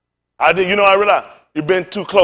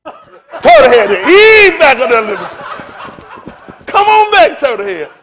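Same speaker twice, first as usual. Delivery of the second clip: slow, high, loud laughing; slow high laughing coax; laughing plea; 7 semitones higher, 9 dB louder, slower than usual. Retold or imitated. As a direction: beg through laughter, drawn out. Retelling fear comically.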